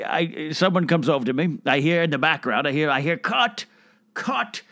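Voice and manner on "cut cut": high-pitched mock director's call; called-out "cut". Imitates a director calling cut.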